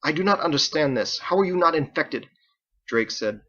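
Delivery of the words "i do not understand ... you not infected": confounded. Acted.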